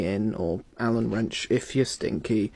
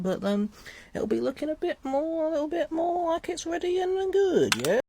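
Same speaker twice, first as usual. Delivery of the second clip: much higher, sweet little put-on voice; cutesy high voice. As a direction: coo it like talking to a pet. Cutesy voice while cleaning guitar.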